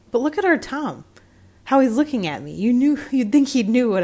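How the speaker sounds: suddenly uneasy, pointing at Tom; startled. A narrator's reading. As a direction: sexton spooked by the staring cat. Sexton startled by the cat.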